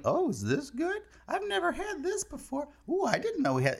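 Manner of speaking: high-pitched mock chirpy cashier; stretched-out, high imitation of a chatterbox; chatty small talk. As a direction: put on a high voice for idle checkout chatter. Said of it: "trying to make small talk".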